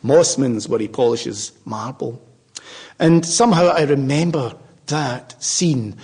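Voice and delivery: ordinary talk, nothing marked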